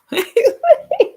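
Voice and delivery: high loud laugh. Real, not acted. Laughs at own joke.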